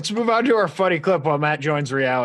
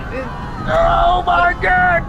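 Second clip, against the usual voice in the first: panicked; 12 semitones higher, 3 dB louder than usual. Acted. Cop panics at a near crash.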